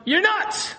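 high-pitched incredulous retort; acted outburst at an obvious absurdity; mock exasperation. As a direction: protest at the top of your range. Congregation's imagined retort to foolish plea.

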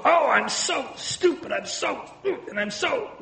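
lightly hammed-up self-blame; mock self-scolding. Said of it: "and we'll go".

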